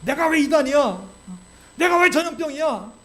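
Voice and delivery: loud, high-pitched mock outburst of protest; hot-tempered shouting. Preacher acting out how Paul would not answer accusers, shouting indignantly. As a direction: yell it like an enraged man losing his temper. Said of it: "바울이 막 소리를 질러가면서".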